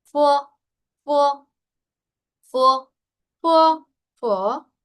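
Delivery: "fo" said slowly with a rounded O after the F, repeated; "fo" modelled for students, loud and precise; pron demo. Shown as a demonstration. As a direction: say "fo" clearly, lips rounded on the O. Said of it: "una consonante más una O".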